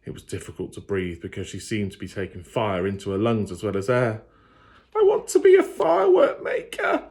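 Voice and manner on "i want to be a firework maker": put-on much higher, small frightened girl voice; timid girl. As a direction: force the words out past fear. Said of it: "she managed to say".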